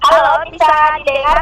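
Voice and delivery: ordinary talk, nothing marked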